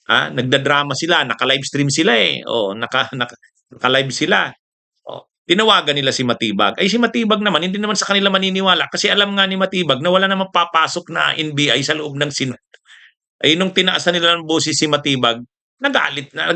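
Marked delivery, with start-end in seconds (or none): none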